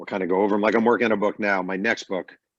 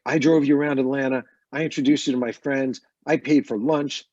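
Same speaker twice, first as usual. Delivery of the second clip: higher-pitched, indignant retold complaint; aggrieved rant; 6 semitones higher, 4 dB louder than usual. Rocker listing grievances to the reporter, indignant higher rant. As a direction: list the favors as if wronged, slowing at the end. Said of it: "He's chewing me out".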